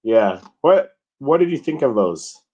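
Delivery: ordinary talk, nothing marked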